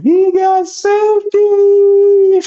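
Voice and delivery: high, long drawn-out taunting yell; mock yell. Friend mimicking grandmother to make fun, long high yell. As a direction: stretch it out in a loud high mimicking holler. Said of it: "My grandmother yelled out".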